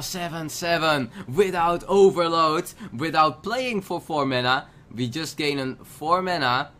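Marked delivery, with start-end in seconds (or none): none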